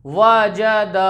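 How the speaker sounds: वजद dragged out as waa-jaa-daa; slow, drawn-out वजद; wrongly stretched vowels. Shown as a demonstration. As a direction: hold every vowel of वजद long. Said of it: "ये इसका गलत तरीका।".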